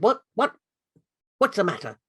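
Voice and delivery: a monkey king startled from sleep; woken king. Acted. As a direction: jolt awake and demand an answer. King wakes suddenly.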